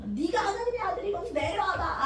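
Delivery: a hint of a jeering bystander; mocking taunt. Passer-by mocking Jesus on the cross, sneering. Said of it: "조롱하는 행인 둘 있잖아".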